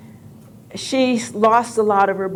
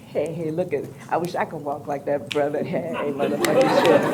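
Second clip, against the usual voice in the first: low, hushed guy voice; mock male bystander, kept soft; imitating onlookers; 6 semitones lower, 6 dB quieter than usual. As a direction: murmur low like an admiring dude. Voices guys remarking on the walk.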